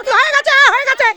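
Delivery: urgent announcing. Real, not acted. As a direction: call out the finish over the noise. Host calls that player is done.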